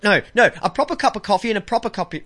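fast tipsy tongue twister. Shown as a demonstration. Tipsy host attempting a tongue twister fast. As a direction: rattle it off quickly. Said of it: "I can actually do that".

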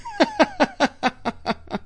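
long, high, staccato giggle; drawn-out high-pitched cackling at your own joke; staccato laugh. Real, not acted. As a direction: laugh in quick high bursts. Laughs at his own refresh gag.

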